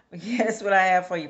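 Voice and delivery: high, loud burst through a laugh. Real, not acted. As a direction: laugh off your stumble, voice jumping up loud. Laughing off her own stumbles.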